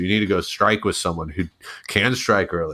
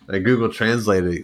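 ordinary talk

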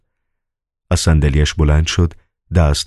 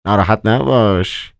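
Koroviev's murmur; 4 semitones higher than usual. Acted. Koroviev consoling the master, murmured. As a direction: murmur it in a higher character voice. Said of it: "کروویف زیر لب گفت".

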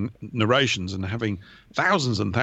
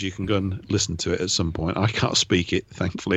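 ordinary talk

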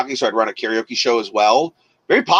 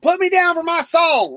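much higher, louder mock demanding drunk; pushy bar regular; 4 dB louder than usual. DJ imitating bar regular, high pushy demand. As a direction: mimic a pushy drunk demanding his song. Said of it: "he comes and goes".

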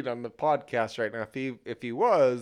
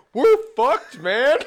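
loud, high panicked soldier; Aliens movie quote; 12 semitones higher, 11 dB louder, slower than usual. Retold or imitated. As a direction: yell it like a doomed marine. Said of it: "pull the classic Aliens line".

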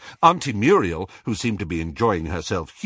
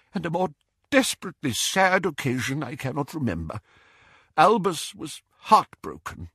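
higher-pitched, halting sorrow; trembling grief; 6 semitones higher than usual. A narrator's reading. Doge recalling the funeral, trembling and sad. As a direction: mourn it with trembling lips. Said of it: "said Doge through trembling lips".

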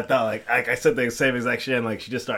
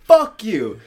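high, loud mock-angry outburst; mad girlfriend; 10 semitones higher, 10 dB louder than usual. Girlfriend fed up with being called names, imitated mad yell. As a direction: imitate her blowing up at you. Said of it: "she got mad at me".

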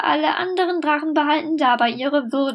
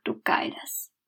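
geflüstert; 8 dB quieter than usual. Acted. Drache wiederholt, tonlos.

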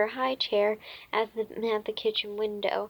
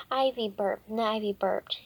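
ordinary delivery, nothing marked

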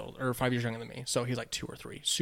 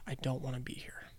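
whispering to himself; a trapped teen whispering his thoughts; whispered thought; 11 dB quieter than usual. Retold or imitated. Bored teen stuck babysitting, whispering to himself. Teen plotting escape inside head.

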